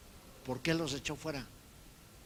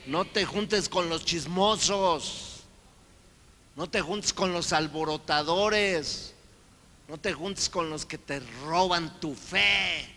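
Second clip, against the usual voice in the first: louder, higher, slow preaching, "No te juntes" hammered; full-force preaching; 6 semitones higher, 8 dB louder, slower than usual. Acted. Preacher warns the congregation against gossips, preaching hard. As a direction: exhort the flock slowly and loudly.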